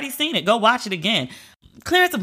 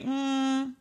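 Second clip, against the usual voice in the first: skeptical; 6 semitones higher than usual. Retold or imitated. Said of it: "And I was like".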